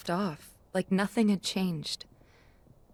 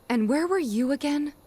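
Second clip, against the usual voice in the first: rising, louder, hurt demand; angry confrontation; 6 semitones higher, 3 dB louder than usual. Acted. Confronting her friend about five missing years, angry and hurt. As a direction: press him harder on each question, voice climbing.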